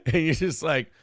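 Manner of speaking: ordinary talk, nothing marked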